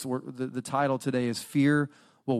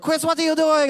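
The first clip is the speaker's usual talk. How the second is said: much higher, loud exasperated wife; a wife finding a fortune on her pillow, annoyed; mock angry yell; 13 semitones higher, 6 dB louder than usual. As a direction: call him out sharply. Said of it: "she gets so mad".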